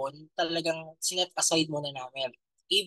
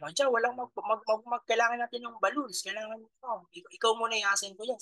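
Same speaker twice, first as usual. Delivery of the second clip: retold mentor giving orders; 7 semitones higher than usual. Mentor voiced in a retold story.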